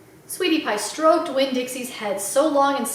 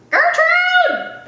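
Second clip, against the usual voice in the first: high, loud squawking parrot cry; parrot scream; 6 dB louder than usual. Acted. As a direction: screech the name like a parrot. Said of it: "the parrot screamed again".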